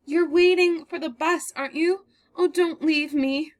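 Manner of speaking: cling to him, crying; frightened, crying. Acted. Girl begging the stranger not to leave her, crying.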